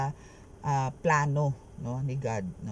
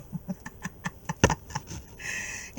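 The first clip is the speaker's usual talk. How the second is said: long voiceless, wheezy laugh; breathy chuckle. Real, not acted. Reader amused that enemies failed, breathy laughing.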